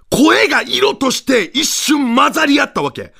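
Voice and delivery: ordinary delivery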